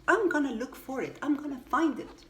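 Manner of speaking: fast and firm, I'm gonna find it; determined declaration. A narrator's reading. Noddy vowing to friends to find the wag, fast and determined. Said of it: "declared Noddy".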